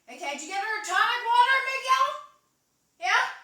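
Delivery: loud, high, quick holler; calling to assistant. Acted. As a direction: shout it across to your helper.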